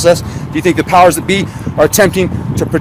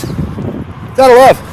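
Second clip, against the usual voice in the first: sarcastic; 6 semitones higher, much slower than usual. Pretend. Sarcastic punchline to the list.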